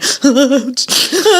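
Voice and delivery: hammed-up hiccupping wails; imitated sobbing. Mom imitating child still crying in bed, sobbing. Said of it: "still going".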